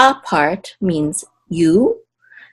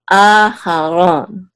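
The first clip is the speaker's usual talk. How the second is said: Aharon slowly, first A held long; secondary accent on A; 3 dB louder, much slower than usual. Shown as a demonstration. Teacher sounding out the name, Aharon slow with long A. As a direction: draw out the A and ron in Aharon. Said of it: "We have a secondary accent here".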